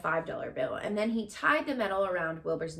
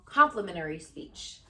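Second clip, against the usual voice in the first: ordinary delivery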